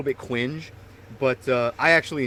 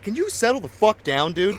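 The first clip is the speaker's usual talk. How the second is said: higher-pitched retold exasperation; exasperated retort; 6 semitones higher than usual. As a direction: tell him off, fed up.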